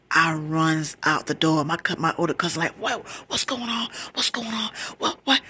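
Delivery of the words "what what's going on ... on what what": high, rapid-fire acted concern; alarmed questioning. Older cousin reacting to her screaming, alarmed. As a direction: imitate a relative in a fluster.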